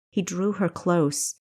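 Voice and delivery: plain reading voice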